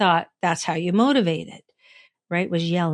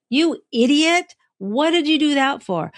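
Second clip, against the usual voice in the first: sharp, higher self-scolding; harsh inner critic; 6 semitones higher, 3 dB louder than usual. Retold or imitated. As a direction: snap at yourself like a harsh critic. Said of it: "sometimes mine's harsh".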